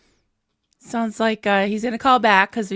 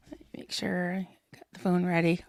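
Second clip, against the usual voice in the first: whispering; 3 semitones lower, 10 dB quieter than usual. Real, not acted. Aside while readying the phone.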